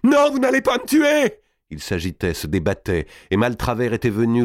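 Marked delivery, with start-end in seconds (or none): 0.0-1.4 very high, loud scream; desperate cry. A narrator's reading. King struggles against his killers, desperate scream. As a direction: scream in terror for your life. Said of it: "cria-t-il".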